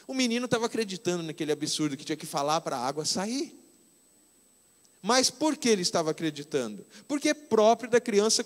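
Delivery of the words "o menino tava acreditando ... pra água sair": ordinary talk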